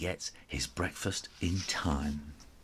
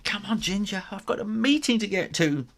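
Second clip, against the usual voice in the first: mum's voice; 12 semitones higher than usual. A narrator's reading. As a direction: hurry the kitten out the door. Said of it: "And Mum's going".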